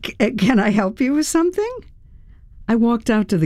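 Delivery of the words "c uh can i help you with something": high, quick, stumbling polite question; surprised, faltering. A narrator's reading. Homeowner to unexpected visitor Joan, surprised and faltering. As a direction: stammer into a polite offer, pitched up.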